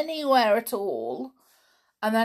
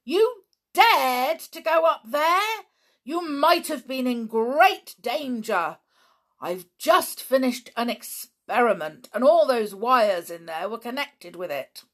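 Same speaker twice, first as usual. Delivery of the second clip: furious scolding; 6 semitones higher, 7 dB louder than usual. Acted. Uncle scolding the children for climbing the tower, furious. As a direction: tear into the children for the danger. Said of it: "in a sudden surprising fury".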